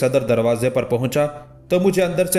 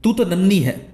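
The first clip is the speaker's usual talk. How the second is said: shouting at the maid; 5 semitones higher than usual. A narrator's reading. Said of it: "चीख के बोला".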